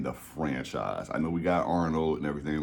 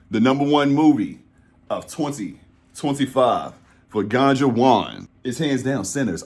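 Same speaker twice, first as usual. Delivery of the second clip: grand announcement; 5 semitones higher, 6 dB louder than usual. Real, not acted. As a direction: build suspense before the winner. Building up to the top pick.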